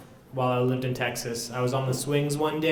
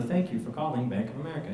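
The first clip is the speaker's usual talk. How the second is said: automated phone voice; 6 dB quieter, faster than usual. Retold or imitated. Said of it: "automated voice messaging system".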